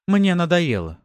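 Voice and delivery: high, loud and angry; fed-up outburst. A narrator's reading. Wife erupting at her husband, angry. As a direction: let the frustration burst out.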